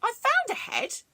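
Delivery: high, loud surprised miller; miller's voice. A narrator's reading. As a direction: exclaim it up high. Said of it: "The miller said".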